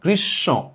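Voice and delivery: "গ্রীষ্ম" with doubled sh and a nasal final o; nasalized ending. Shown as a demonstration. Teacher showing the chandrabindu sound, nasalized ending. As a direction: pull the end of "গ্রীষ্ম" through the nose. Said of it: "নাকের টান".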